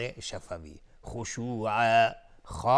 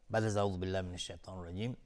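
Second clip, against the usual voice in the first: usual speaking voice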